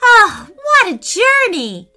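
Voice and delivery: high, loud joyful exclamation; delighted squeal. A narrator's reading. Preston back home in the basement, delighted squeal. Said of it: "Preston squealed with delight".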